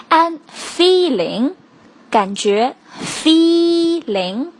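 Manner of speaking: feeling said slowly as one smooth word; slow model feeling. Shown as a demonstration. Teacher modelling feeling slowly for students. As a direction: draw out feeling without splitting it. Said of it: "一定不要把它拆成两个单词".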